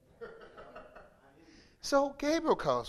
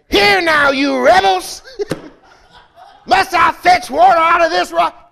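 much louder, higher rebuke; angry shout; 6 semitones higher, 13 dB louder than usual. Retold or imitated. Moses yelling at the congregation in anger, loud and high. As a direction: roar at the rebels. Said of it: "That ain't what God said".

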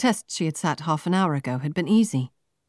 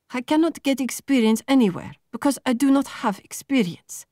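higher, frustrated demand; plain angry; 10 semitones higher, 3 dB louder than usual. A narrator's reading. Marta confronting the recruiters, openly angry. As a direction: push back hard at the interviewer. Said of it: "plain angry now".

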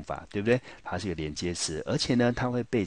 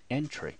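"entry" with the weight on EN and a light try; teacher modeling the stress in "entry"; stress on first syllable; 8 semitones higher than usual. Shown as a demonstration. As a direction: lean on EN in "entry" and let try drop. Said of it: "重音我们落在第一个音节".

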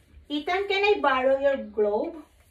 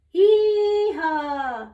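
sing-song hee-haw; 4 dB louder than usual. Pretend. Mother voices donkey in book.

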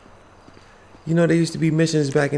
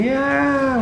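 mock scream. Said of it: "raise hands and screaming".

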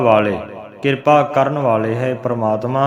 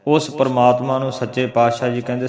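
usual speaking voice

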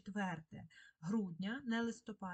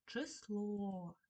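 число with a long stressed last vowel; stress on final О. Shown as a demonstration. Teacher showing children where stress falls, число stressed on О. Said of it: "наголосна О".

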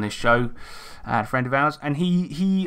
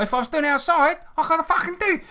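high-pitched mock flustered outburst; hammed-up, much higher imitation; Nick. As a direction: squeal it as a mock impression of a flustered friend. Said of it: "That's my Nick impression".

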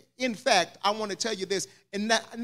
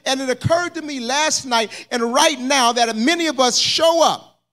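loud, higher, climbing sermon cadence; preaching at full force; 6 semitones higher, 6 dB louder than usual. Acted. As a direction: preach it with rising fire.

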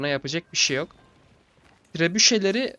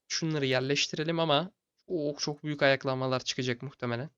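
normal talking voice

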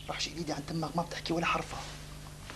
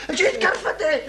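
ordinary talk, nothing marked

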